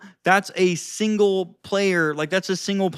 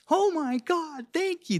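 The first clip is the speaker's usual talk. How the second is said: flattered woman; 10 semitones higher, 4 dB quieter than usual. Acted.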